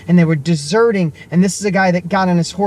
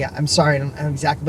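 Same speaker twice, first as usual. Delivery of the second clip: ordinary talk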